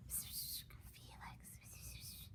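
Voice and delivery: soft unvoiced whisper of a name. Classmate whispering Felix's name to bother him. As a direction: whisper it so the teacher won't hear.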